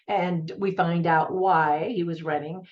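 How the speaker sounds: usual speaking voice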